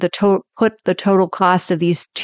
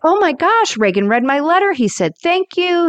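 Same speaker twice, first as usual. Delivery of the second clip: high-pitched mock excitement; thrilled gushing; 10 semitones higher than usual. Acting out Rose after the President's phone call, high excited gushing. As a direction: gush like someone just thanked by the President.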